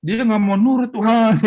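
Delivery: high, loud vengeful plea; vengeful prayer. Retold or imitated. Wife praying aloud against her husband, high and loud. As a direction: pray for God to strike him, loud and high. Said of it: "Tapi doanya yang gini".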